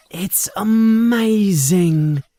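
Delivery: slow, high, wide-eyed delight; happily amazed. Acted. Harry admiring Ron's house, high and amazed. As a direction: let the wonder spill out slowly. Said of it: "says Harry happily".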